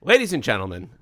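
big booming show intro; announcer voice. Acted. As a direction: announce it like a ring host. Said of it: "[name], do it".

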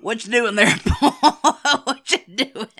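loud mock American Southern accent; [name]. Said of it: "when [name] does an American accent".

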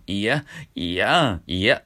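slow いやん pushed through the nose; coy nasal いやん. Shown as a demonstration. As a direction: let いやん ring in the nose. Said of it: "鼻に抜けるんですよ".

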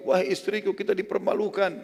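high, flustered mock call; acted bewilderment. Jabir calls his wife before opening the door, acted bewilderment. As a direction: call out in imitated dismay, pitched high. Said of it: "tapi dia bingung".

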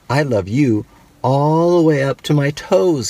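a hint of a tiny hare; little hare voice. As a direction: sing it up like a small upside-down hare. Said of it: "he said".

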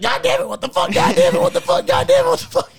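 very high, loud screeched mock voice; outraged grandma. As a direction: screech it over and over like a startled grandma. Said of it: "my grandma wakes up".

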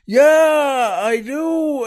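high, loud long-distance shout; shouting back. Acted. Stranger out in the rain answering the husband, shouting back. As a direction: yell it so it carries.

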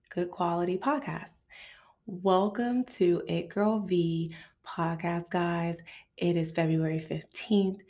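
soft slow whisper. Acted. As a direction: whisper the welcome. Whispered podcast intro.

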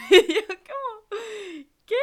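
bursting out laughing, slow and high; loud laughing lead-in. Real, not acted. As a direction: laugh through the words in disbelief. Shocked by the gift.

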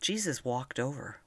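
plain reading voice

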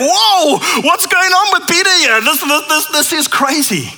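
high, startled "Whoa" and disbelief; shocked onlookers. Retold or imitated. Disciples watch Jesus rebuke Peter, high and startled. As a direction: gasp at how fast it turned. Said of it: "It's like".

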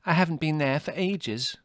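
high, light offhand reply; airily. A narrator's reading. Margot naming the far destination, airy. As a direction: brush it off lightly. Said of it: "said Margot airily".

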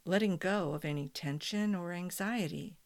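guided meditation. Acted. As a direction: guide them gently into calm.

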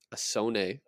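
"sonnet" said as "so-NAY"; French-style ending. Shown as a demonstration. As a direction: rhyme the end of "sonnet" with "day". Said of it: "I don't know if it's pronounced".

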